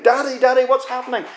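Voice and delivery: a hint of a frightened kid calling dad; mild child's cry. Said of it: "your children start screaming".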